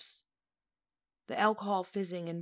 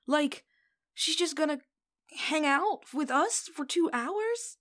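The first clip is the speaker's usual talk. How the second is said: excited disbelief; 9 semitones higher, 6 dB louder, slower than usual. Acted. Excited fan disbelief continues.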